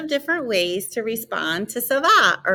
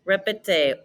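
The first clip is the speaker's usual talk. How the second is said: ordinary talk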